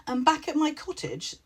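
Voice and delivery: cheery witch, full of good news; cheerful. A narrator's reading. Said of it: "the witch said with cheer".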